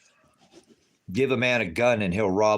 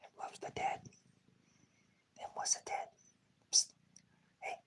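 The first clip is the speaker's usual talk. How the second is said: whisper; 14 dB quieter than usual. Real, not acted. Owner whispering baby talk to his cat, whisper. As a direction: hush it right into the kitten's ear.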